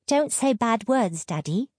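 put-on high child's voice, mildly alarmed; alarmed little daughter; little girl piping up. A narrator's reading. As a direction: pipe up high like a small child. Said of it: "Little Ruth piped up, her face alarmed".

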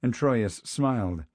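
plain reading voice

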